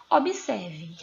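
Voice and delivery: ordinary delivery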